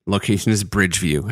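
usual speaking voice

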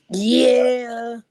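loud, high raspy "yeah" yelled like a rap ad-lib; signature yeah ad-lib. Acted. Rapper drops his trademark ad-lib for the fans. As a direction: belt the yeah like on a track.

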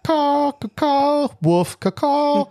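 high clumsy mock bird caws; bad bird call imitation. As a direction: squawk like a bird that forgot its song. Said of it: "It's sitting there like".

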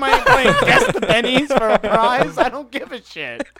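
laughing rant. Real, not acted.

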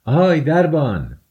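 loud, high call out to someone; shouted call. A narrator's reading. Raskolnikov hailing the doorkeeper at the gate, shouted call. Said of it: "فریاد زد".

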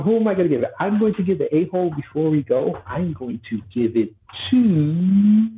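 hammed-up presenter building suspense; mock award announcement. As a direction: announce a winner, drawing out the end.

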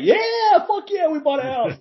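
very high, hammed-up cheer; mock hype. Mocking the cheer he never gave about the house, very high. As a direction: fake a wild celebration. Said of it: "but I wasn't like".

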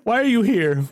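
lightly high nervous noble; frightened noble. Acted. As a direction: ask in a high, timid voice. GM voices the noble.

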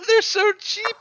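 very high voice breaking into laughter; helpless laughing. Real, not acted. Co-host mocking cheap canned potatoes, cracking up. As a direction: squeeze the words out through real laughter.

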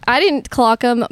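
ordinary talk, nothing marked

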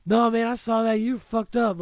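loud, high call-out of the driver; mock yell. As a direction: shout him down as the friend. Friend yelling at the driver.

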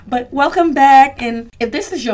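loud, high, bursting welcome back; excited welcome. Real, not acted. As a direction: throw the doors open for returning listeners. Host greets listeners to new season.

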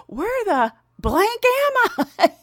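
high, drawn-out comic bewilderment; hammed-up confusion breaking into laughter; acted bewilderment. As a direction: wake up lost, slow and high-pitched. Acts out waking lost in van.

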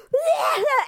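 high, loud half-laughing yelp; strangled laugh-cry. Retold or imitated. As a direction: yelp in mock panic through a laugh. Retelling choking panic.

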